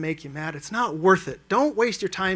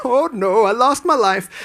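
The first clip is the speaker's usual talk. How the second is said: his own take, raised but less sing-song; a lighter, louder copy of the deer voice; roadkill narration; 4 semitones higher, 6 dB louder, slower than usual. Retold or imitated. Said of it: "Now I do it too".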